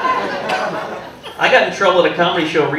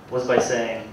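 normal talking voice

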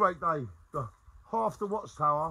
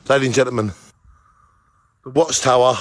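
loud; 4 semitones lower, 12 dB louder, faster than usual. Real, not acted. Announcing to audience.

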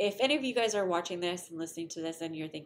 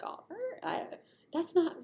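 mock protesting student; 11 semitones higher, 3 dB louder than usual. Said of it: "And they're like".

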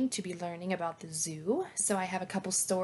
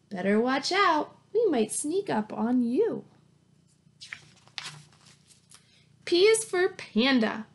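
bright, lively picture-book read-aloud; reading to children; 4 semitones higher, 4 dB louder than usual. Acted. Teacher reading a zoo alphabet book to her class, lively read-aloud. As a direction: read it like story time for little kids.

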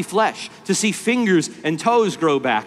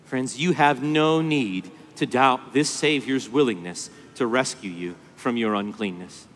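ordinary delivery

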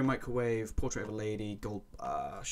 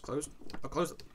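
ordinary talk, nothing marked

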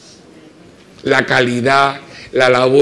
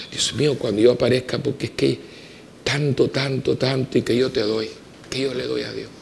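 much softer, murmured to God; hushed prayer; 8 dB quieter than usual. Retold or imitated. Priest retelling his quiet prayer to God, murmured.

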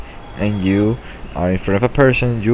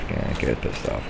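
much softer and lower, muttering "Can't get pissed off." to yourself; quiet self-calming mutter; 7 semitones lower, 11 dB quieter than usual. Real, not acted. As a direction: talk yourself down under your breath. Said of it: "Calm down".

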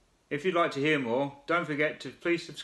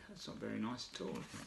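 quiet aside; 2 semitones lower, 16 dB quieter, faster than usual. Real, not acted. Aside to children.

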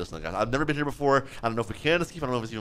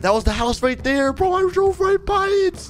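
high-pitched burst of alarm; rising, frantic exclamation; alarmed realization. Real, not acted. Player realizing he drove right past the house, alarmed.

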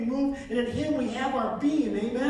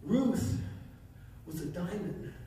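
hushed voice of the Lord; 7 semitones lower, 7 dB quieter than usual. Retold or imitated. Preacher retelling the Lord's words, soft and low. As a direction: speak it gently like a divine whisper. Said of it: "I heard the Lord say to me".

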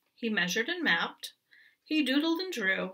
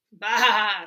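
scoffing bah; 8 dB louder than usual. Acted. Goat scoffs.

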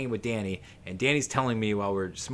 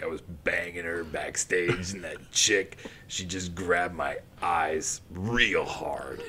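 Phil; 4 semitones lower than usual. Retold or imitated. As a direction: drag the words out low, like a stoned reminiscence. Acting Phil telling Jimmy a story.

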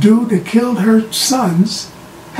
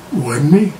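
deadpan denial, imitating the Penguin; [name]-style denial; 3 semitones lower than usual. Penguin answering the room's accusation, deadpan denial. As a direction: deny it coolly, like the song. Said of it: "He went straight [name] on him".

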